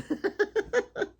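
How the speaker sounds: real laugh. Real, not acted. Laughing at granddaughter's remark.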